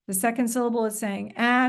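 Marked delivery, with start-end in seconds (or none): 1.4-1.7 ah as the short A in "cat"; short vowel sound. Shown as a demonstration. Teacher showing the second syllable's vowel, short vowel sound. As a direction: sound out ah alone, short A with a rise. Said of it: "it's got a short sound".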